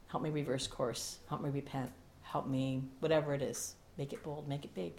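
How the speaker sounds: soft, low pleading; hushed prayer. Acted. Pastor ending the prayer, soft and pleading. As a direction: drop almost to a breath.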